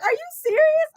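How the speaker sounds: imitated friend's disbelief, very high-pitched; incredulous friend. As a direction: squeal it in mock disbelief. Voices her disbelieving friend.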